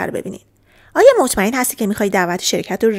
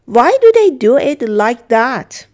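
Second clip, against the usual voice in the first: emphatic model sentence; 3 semitones higher, 8 dB louder, much slower than usual. Shown as a demonstration. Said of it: "teacher models English line".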